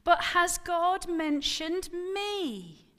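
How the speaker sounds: high, loud and slow; exclaiming. Acted. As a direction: demand it, each word weighed. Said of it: "she exclaimed".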